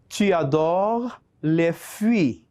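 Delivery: slow, word-by-word French dictation; measured teacher's dictation with spaced words. Shown as a demonstration. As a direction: dictate it slowly for learners to write down. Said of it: "te voy a dictar cinco oraciones cortas".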